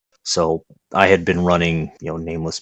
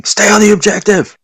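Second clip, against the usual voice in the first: high, loud mock scream; imitating an exasperated player screaming orders; imitated screaming; 11 semitones higher, 6 dB louder than usual. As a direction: yell it like a furious teammate. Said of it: "and just screaming".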